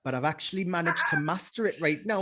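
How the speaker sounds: confident Geordie accent; Newcastle accent. Shown as a demonstration. Showing the co-host a mastered Newcastle accent, Geordie. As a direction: talk like a Geordie. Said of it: "Okay, what about Newcastle?".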